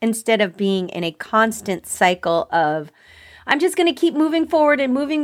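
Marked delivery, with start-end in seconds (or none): 3.5-5.2 fast, higher-pitched run-on inner voice; rushed self-talk. Acted. Voicing the constant cycle of avoiding feelings, rushed. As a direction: rattle it off like someone who cannot stop.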